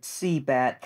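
usual speaking voice

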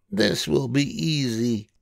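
high, cheerful storybook voice for Amelia; Amelia Bedelia voice. A narrator's reading. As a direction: lift into a bright girlish character voice. Amelia reads Grace's note.

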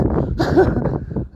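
short breathless laugh; relieved laugh. Real, not acted. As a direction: laugh off a near fall. Laugh after nearly falling.

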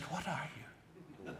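very soft, slow retold whisper; hushed question. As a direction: ask it low and barely audible, imitating a secretive reply. Woman answers his conspiratorial whisper.

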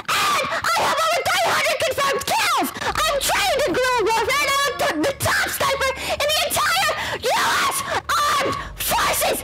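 hammed-up high-pitched furious rant, shouted from off the mic; over-the-top shouted threats; enraged tough guy. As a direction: rage theatrically like an insulted commando. Performs an enraged threat copypasta.

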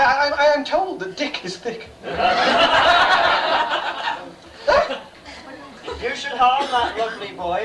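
ordinary talk

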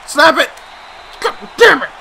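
high, loud angry outburst; frustrated yell. Real, not acted. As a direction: explode at the game. Frustrated at controls.

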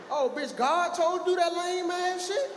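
ordinary talk

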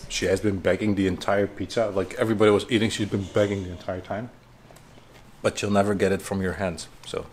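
real hushed, low-pitched talk; low and soft, as near a sleeper; keeping voice down. Real, not acted. Telling viewers about the begging dog while the baby sleeps, keeping voice down. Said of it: "so I cannot talk too loud because Jason is also sleeping".

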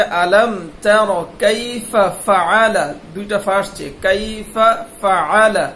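কাইফা ফাআলা with both ফা breathy and clear; careful recitation. Shown as a demonstration. Teacher models the verse slowly for pupils. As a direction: recite slowly, sounding each ফা. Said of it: "এখানে বলতে হবে".

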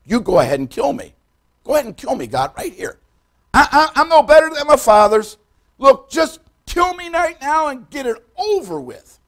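rising, loud desperate begging, slowing at the end; despairing plea. Acted. Elijah begging God to kill him, loud and desperate.

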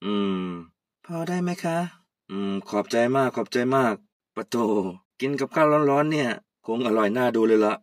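put-on low, moved old man thanking her; old uncle, touched.

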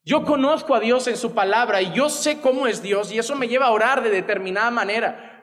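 usual speaking voice

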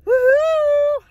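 high, loud, drawn-out woohoo; a gleeful high holler to viewers; excited whoop. Real, not acted. Cheering to open the vlog.

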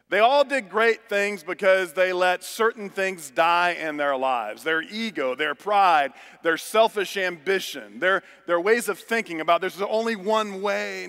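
high, loud, driving preacher cadence; full-force preaching. Acted. Pastor preaching to the congregation, loud and driving. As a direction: preach it, building to the crowd.

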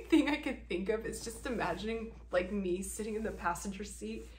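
usual speaking voice

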